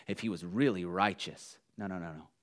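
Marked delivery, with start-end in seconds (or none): 1.8-2.3 very soft and low, almost under the breath; preacher softly dismissing the idea that Jesus shuns sinners; soft dismissive no's. Real, not acted. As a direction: shake it off quietly.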